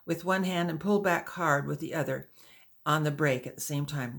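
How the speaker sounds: ordinary delivery, nothing marked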